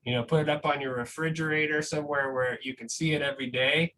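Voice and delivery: ordinary talk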